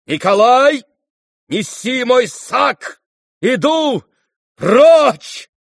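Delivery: slow, high, full-force cursing shout; furious shouting. A narrator's reading. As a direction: cry it out in extreme wrath, each word drawn out. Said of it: "поднял руки и прокричал". (гневный крик во весь голос)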